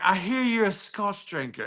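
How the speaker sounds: lightly put-on South African voice; South African accent. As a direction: imitate a South African man. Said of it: "He's got this beautiful accent".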